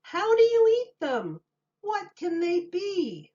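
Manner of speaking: a high, wondering voice; high townsfolk voices. A narrator's reading. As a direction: ask it like a crowd of curious townsfolk. Townspeople puzzled chorus.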